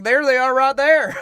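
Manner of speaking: loud, high, slow alarmed call; freaked-out Southerner. Retold or imitated.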